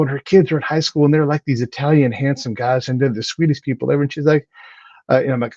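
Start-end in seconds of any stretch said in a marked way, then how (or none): none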